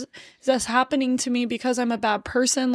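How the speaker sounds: normal talking voice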